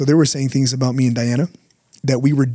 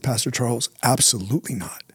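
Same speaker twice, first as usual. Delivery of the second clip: softer, subdued earnest denial; 2 semitones lower, 7 dB quieter than usual. Retold or imitated. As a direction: deny it quietly and sincerely. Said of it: "And I said".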